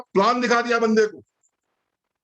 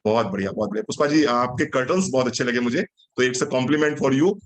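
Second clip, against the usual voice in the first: lovingly polite; 7 semitones lower than usual. Shown as a demonstration. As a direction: greet her sweetly like a good networker. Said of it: "बड़े प्यार से आपसे बोलेगा". (प्यार से, धीमे और नम्र लहजे में)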